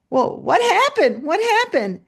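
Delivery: high, loud clamouring questions; eager kids. Retold or imitated. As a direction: imitate excited children begging to know. Class clamours for the story.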